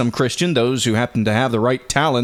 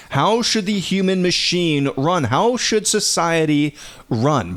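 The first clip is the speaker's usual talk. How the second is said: usual speaking voice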